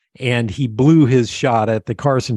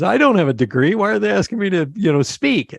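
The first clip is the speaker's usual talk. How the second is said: raised, incredulous New York voice; puzzled New Yorker, pitched up; New York accent; 8 semitones higher, faster than usual. Retold or imitated. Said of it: "thick New York accent".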